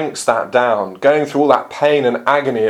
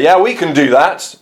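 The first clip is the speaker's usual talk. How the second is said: confidently quoted, raised and louder; 5 semitones higher, 5 dB louder than usual. Acted. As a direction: say it brightly sure of yourself, as the disciples. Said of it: "James and John very confidently say".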